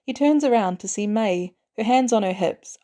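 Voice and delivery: ordinary delivery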